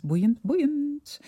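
high, delighted repeat of boeiend; excited. Real, not acted. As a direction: gush the word, climbing up. Repeats word with growing enthusiasm.

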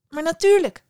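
put-on high, loud little girl's reply; eager girl. Girl agrees to help the kind woman, high eager reply. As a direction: agree at once, high and keen.

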